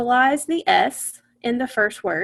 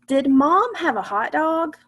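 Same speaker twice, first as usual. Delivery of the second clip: "Did Mom have a hot dog?" read with a clear asking lilt, a little higher; question reading; 5 semitones higher than usual. Shown as a demonstration. Teacher reading the sentence for the class to hear as a question. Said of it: "Listen to that sentence carefully".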